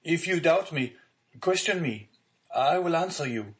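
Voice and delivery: anguished prisoner crying out to the judge; desperate cry. A narrator's reading. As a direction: throw yourself on his mercy. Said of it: "cried the unhappy young man".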